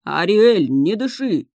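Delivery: urgent cry through bubbling water; gurgling cry. Acted. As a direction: gurgle it out like drowning. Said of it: "булькнул Себастьян".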